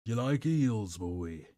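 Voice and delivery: playful put-on Cockney, falling in pitch; Cockney accent. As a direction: tease in mock Cockney. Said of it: "anyone with a Cockney accent".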